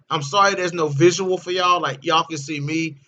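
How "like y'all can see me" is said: normal talking voice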